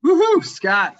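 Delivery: loud, high whoop of joy; excited cheer. Real, not acted. Excited cheer at his welcome.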